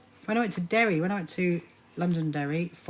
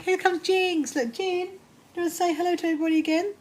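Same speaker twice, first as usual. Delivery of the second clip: cooing at her cat Jinx in a high pet voice; 11 semitones higher than usual. Real, not acted. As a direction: coo at the cat in a much higher voice.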